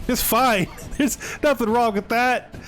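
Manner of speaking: high-pitched, amused self-justification; laughing it off. Real, not acted. Laughing off own questionable move.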